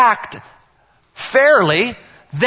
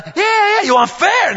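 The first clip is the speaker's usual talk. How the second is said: put-on kids egging on; 12 semitones higher, slower than usual. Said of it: "And she's going".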